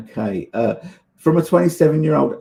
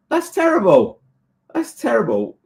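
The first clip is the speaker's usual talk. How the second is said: aghast dismay; 11 semitones higher than usual. Real, not acted. Repeating dismay over lost order.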